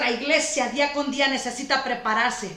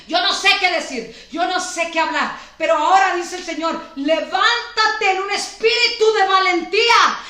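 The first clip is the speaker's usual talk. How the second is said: preaching loud and fast, building to shouts; prophesy over the church at full force; fervent preaching; 4 semitones higher, 4 dB louder than usual. Acted. Pastor prophesying to the congregation, fervent preaching.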